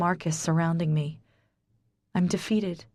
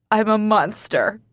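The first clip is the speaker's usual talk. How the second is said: wailing; 2 semitones higher, 4 dB louder than usual. Acted. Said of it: "I wail".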